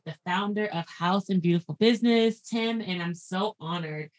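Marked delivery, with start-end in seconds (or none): none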